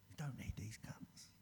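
sneaky whispered put-down; tempter's whisper. Acted. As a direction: hiss it like a secret. Illness voiced whispering.

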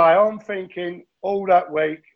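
ordinary talk

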